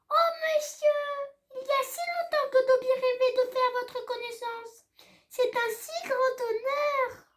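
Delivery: high squeaky elf, awed and humble; a tiny creature overwhelmed to meet his hero; eager house-elf. A narrator's reading. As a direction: starstruck little elf voice. Dobby voiced by the reader.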